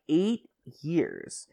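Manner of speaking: usual speaking voice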